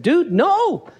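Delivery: high-pitched mock protest; simple guy objecting. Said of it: "And if he's there, he said".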